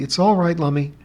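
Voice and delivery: ordinary delivery, nothing marked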